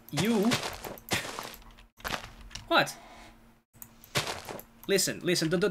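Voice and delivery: ordinary talk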